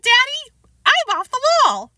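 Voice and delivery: high loud proud boast; proud child. Acted. Boy tells his father proudly, high child voice. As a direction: boast it with glee.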